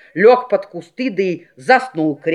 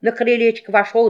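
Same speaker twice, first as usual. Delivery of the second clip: ordinary delivery, nothing marked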